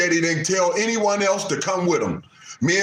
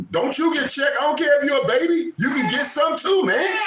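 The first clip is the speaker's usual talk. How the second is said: mock high warning shout; 9 semitones higher than usual. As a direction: squeal a warning at the kids, up high. Warning kids to mind business.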